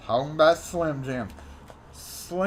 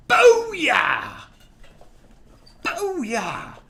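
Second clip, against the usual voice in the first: high triumphant shout; booyah shout; 10 semitones higher, 4 dB louder than usual. Real, not acted. Host cheering after finishing the letter, triumphant shout. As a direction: cheer it out in victory.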